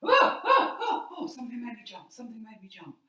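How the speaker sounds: jump, then laugh nervously; mock startled yelp trailing off; frightened jump. Shown as a demonstration. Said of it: "try walking around the room again".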